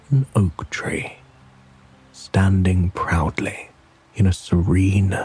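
ordinary delivery

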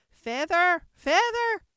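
high, very loud repeated command; insistent high holler; Sheep's cry. A narrator's reading. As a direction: shout it twice as the bossy Sheep. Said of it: "the sheep cried again".

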